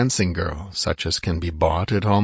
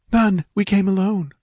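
put-on high, timid girl's voice; frightened girl; 12 semitones higher than usual. A narrator's reading. Captive girl answers Conan, high and timid. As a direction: answer small and scared.

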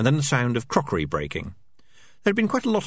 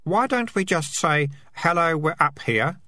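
innocent; 9 semitones higher, slower than usual. Acted. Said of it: "said Keith innocently".

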